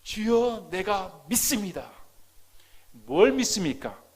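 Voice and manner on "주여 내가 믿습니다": raised, forceful declaration to the Lord; fervent cry of belief; confession of faith. Acted. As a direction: confess it boldly and loudly. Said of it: "그가 고백하는 게".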